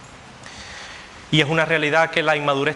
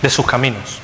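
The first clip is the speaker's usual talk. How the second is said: full-force preaching. Acted. Preacher thundering to the congregation about God's judgment. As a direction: proclaim it to the whole church at full force.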